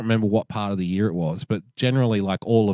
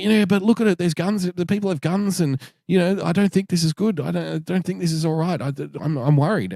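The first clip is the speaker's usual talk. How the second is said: higher imitating a fretful worrier; mock worried backpacker; 7 semitones higher than usual. The Dutch guy pleading with his boss about guns, higher mock worried voice. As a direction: lightly play a frightened newbie pleading.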